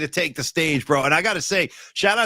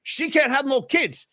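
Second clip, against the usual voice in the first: higher mock Montana rant; imitating Tony Montana; 7 semitones higher than usual. Host retells a Scarface scene, mock Montana rant. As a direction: snap it at the dinner table. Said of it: "He just looks over and goes".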